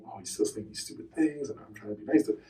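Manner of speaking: hushed, private grumble; very soft, retold irritation; annoyed. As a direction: complain under your breath. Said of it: "certainly annoyed by a certain point".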